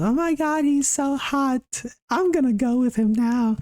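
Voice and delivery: very high, mock-girly swoon; swooning girlfriend. Girlfriend gushing over the hot intruder, hammed-up high swoon. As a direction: squeal it like a lovestruck girlfriend impression. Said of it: "my shawty goes".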